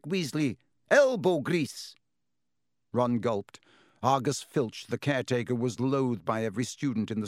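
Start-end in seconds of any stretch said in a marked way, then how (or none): 0.0-1.9 high, loud, slow "Elbow grease"; strict teacher dictating the chore; stern order. Acted. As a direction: drive the order home.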